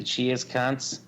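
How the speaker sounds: put-on light Australian accent sign-off; Aussie accent. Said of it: "I'll even do it in the Aussie accent".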